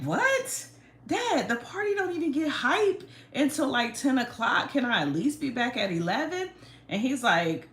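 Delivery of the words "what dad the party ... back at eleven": put-on high, loud whining teenager; whiny teen protest. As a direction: complain like an outraged teen. Teen self protesting to dad.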